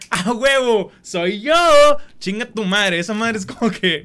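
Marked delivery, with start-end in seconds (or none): 0.1-1.5 high, smug mock voice; mock proud poser. Mocking people who post reels about themselves. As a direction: brag like someone sharing a reel. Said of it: "comparten reels así y dicen".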